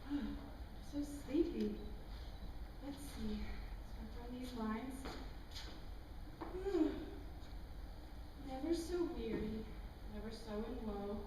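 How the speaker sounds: softly drifting off, weary and drowsy. Acted. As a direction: fade into sleep as you recite. Growing sleepy, reciting weary verse.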